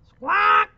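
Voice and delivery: macaw squawk. Acted. Teacher reading to kids, squawking high like a macaw. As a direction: screech it out like a macaw. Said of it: "says the macaw".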